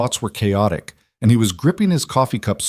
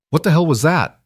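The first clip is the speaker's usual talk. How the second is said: higher, demanding, voice shaking; shaky demand; 7 semitones higher, 3 dB louder than usual. A narrator's reading. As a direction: confront him, voice trembling. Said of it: "her voice shaking".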